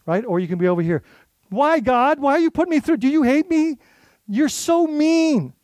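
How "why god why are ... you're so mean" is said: high, whining complaint to God; whiny complaint. Pretend. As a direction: pitch up and whine like a hurt child.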